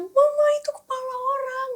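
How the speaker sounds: put-on high-pitched child's cry to mama; imitated frightened child. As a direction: mimic a scared little boy calling his mother. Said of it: "Dia lari ke mamanya bilang".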